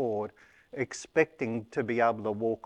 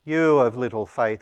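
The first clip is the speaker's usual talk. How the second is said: rebuke; 8 dB louder than usual. Retold or imitated. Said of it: "he said".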